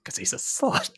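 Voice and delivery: unvoiced mock aside; whispered tease. Real, not acted. Teasing a friend, whispered aside. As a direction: whisper it like a secret.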